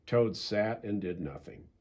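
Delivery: ordinary delivery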